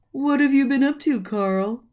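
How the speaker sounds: high, quick and chatty to his candy friend; cheerful character voice. Acted. Rumpel chatting happily to Candy Corn Carl, high and quick.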